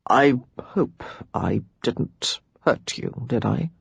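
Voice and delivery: slow, unvoiced whisper; a slow remorseful whisper; hushed and ashamed. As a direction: whisper it, afraid of the answer. Remorseful, fearing he hurt her.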